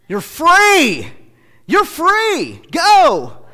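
high, loud joyful release; Jesus releasing you. Retold or imitated. Preacher voicing Jesus telling sinners to go free, high and loud. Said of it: "Jesus tells you".

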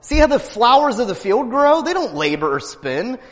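high, loud preaching; fiery preaching. Acted. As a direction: preach it out to the congregation. Preaching at full force.

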